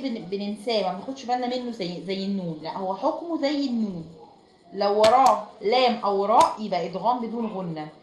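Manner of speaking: usual speaking voice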